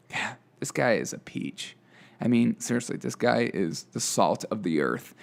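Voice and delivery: low, soft mock sincerity; understated sarcastic reverence; deadpan sarcasm. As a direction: praise him drily, dropping your pitch. Sarcastic praise of a host.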